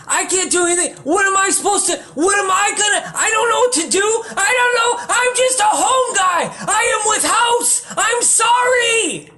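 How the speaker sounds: frantic spiral into panic; panicked rant. Acted. Comic panicking about the man outside, frantic yelling.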